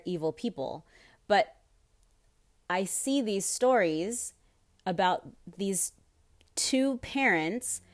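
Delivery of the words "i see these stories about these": ordinary delivery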